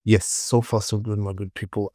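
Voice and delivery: ordinary delivery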